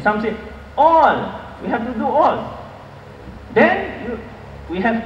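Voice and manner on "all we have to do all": higher-pitched, insistent "All"; emphatic quoted claim. Retold or imitated. Teacher mimicking those who want everything taught, emphatic. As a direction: stretch "All" high, then let it fall.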